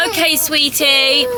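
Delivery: high, slow, loud cooing. Real, not acted. Mum to her crying baby, high drawn-out reassurance.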